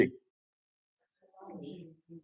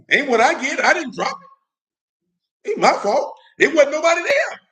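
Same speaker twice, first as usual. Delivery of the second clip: defensive; 11 semitones higher, 4 dB louder than usual. Pretend. Mock denial about dropped ten.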